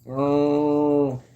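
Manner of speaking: high, drawn-out wail; one long hammed-up cry of alarm; mock fright. Streamer playing an explorer spotting a ghost, drawn-out mock fright.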